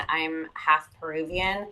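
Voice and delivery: ordinary delivery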